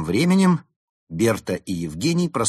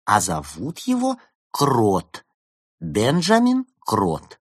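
very slow, stretched words; patient explanation; 4 dB louder, much slower than usual. A narrator's reading. Fox spelling out the name to cat, patiently slow. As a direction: explain as if to a small child. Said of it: "терпеливо объяснила Берта".

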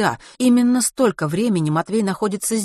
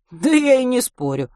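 higher, louder protest; defensive agreement; 7 semitones higher, 5 dB louder than usual. A narrator's reading. Driver to the woman arguing about the comet, defensive. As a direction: back off the argument in a raised voice. Водитель оправдывается перед коллегой.

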